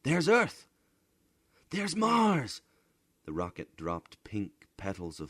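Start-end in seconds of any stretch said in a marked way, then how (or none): 0.0-2.6 put-on high, loud child voices; children shouting. A narrator's reading. Children at the ports shout excitedly. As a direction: point and shout in wonder. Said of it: "The children shouted".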